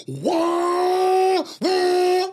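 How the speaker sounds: high, loud mock wailing "wah"; a loud, high wail from the passenger seat; mock scream. Friend screaming at acceleration.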